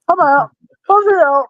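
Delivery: very high squeaky sign-off. Retold or imitated. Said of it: "how Buzzard Bob talks".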